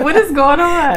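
laughing, mock confused; laughing quote. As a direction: toss it out mid-laugh. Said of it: "I'm like".

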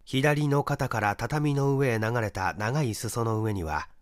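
usual speaking voice

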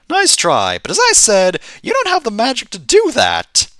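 high-pitched, dismissive guard; guard character. Acted. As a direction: pitch the guard way up, brushing them off. Guard voice refuses the player.

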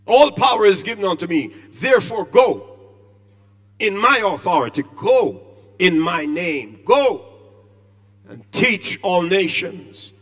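loud, ringing pulpit delivery, drawing out authority; preaching at full force. Acted. Preacher to his congregation on Christ's authority, booming. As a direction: proclaim it to the whole church. Preacher proclaims the Great Commission.

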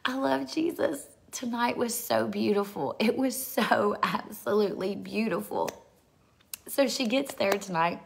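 high, emotional joy; overcome with happiness. Real, not acted. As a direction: overflow with real happiness, pitched high. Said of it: "I'm just still so happy".

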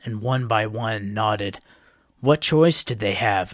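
ordinary delivery

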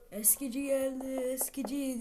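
loud sing-song peddler cry; street vendor call. Retold or imitated. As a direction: call it out like a junk dealer. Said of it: "Eskici geldi, eskici diye bağırmana gerek yok".

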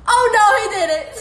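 high, loud and fast, half crying with laughter; laugh-crying shriek. Real, not acted. Streamer cracking up at a fan's comment, laughing shriek. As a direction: squeal it while cracking up.

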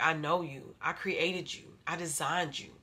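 soft, hushed voice of God; quietly assured divine voice; God speaking. Retold or imitated. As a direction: say it quietly, as God knowing His child. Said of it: "'cause God is like".

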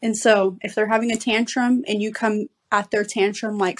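normal talking voice

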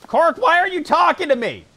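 high, loud startled outburst; surprised and shouting. Real, not acted. Startled by Cork speaking.

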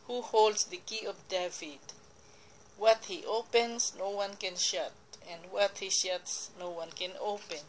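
usual speaking voice

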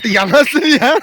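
high, loud amazed cry; surprised. Real, not acted. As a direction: exclaim in disbelief. Hayvanlara şaşırıyor. (yüksek sesle şaşırarak)

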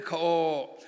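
normal talking voice

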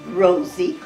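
ordinary delivery, nothing marked

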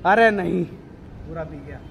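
normal talking voice

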